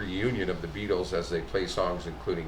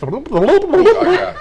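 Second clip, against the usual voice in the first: loud laughing oh; 15 dB louder than usual. Real, not acted. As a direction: burst out laughing. Burst of laughter.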